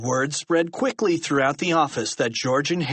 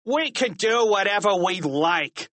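raised, defiant teacher's retort; sneering higher-pitched defiance; snarling; 7 semitones higher than usual. A narrator's reading. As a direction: snarl it back smugly. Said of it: "snarled Misdemeanor".

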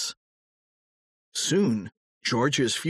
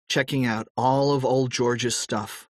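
plain reading voice

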